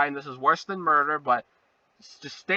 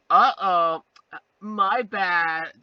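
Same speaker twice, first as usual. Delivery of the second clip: sheepish mock; 5 dB louder, much slower than usual. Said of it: "I'm just gonna go like".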